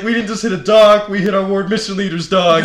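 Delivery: high, loud retelling of panic; frantic realization. Retold or imitated. Retelling to his companion whose dog they hit, frantic realization. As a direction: raise your voice as the disaster sinks in.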